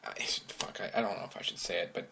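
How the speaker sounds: hushed whisper, almost unvoiced. Real, not acted. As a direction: drop to a secretive whisper. Confessing he smokes, hushed.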